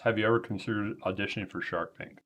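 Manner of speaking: reading aloud while chewing; words around a mouthful of food; mouth full. Real, not acted. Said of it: "Your mouthful".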